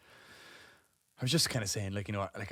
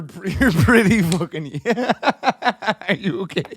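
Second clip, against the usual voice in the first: words broken up by real laughter, louder; can barely get the words out for laughing; 6 semitones higher, 10 dB louder, slower than usual. Real, not acted. Dad cracks up retelling the breastfeeding line, laughing.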